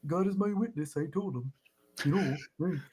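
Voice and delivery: quiet lightly Midwestern voice; hint of a bashful Wisconsin farmer; Wisconsin Ed Gein. Playing Ed Gein for the co-host, lightly Wisconsin accent. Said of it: "break into that".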